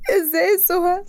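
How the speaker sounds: high wailing sob; crying. Acted. Grieving girl asks her friend, high crying wail. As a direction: cry the question out. Breaking down in grief.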